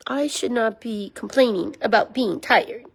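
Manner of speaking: ordinary talk, nothing marked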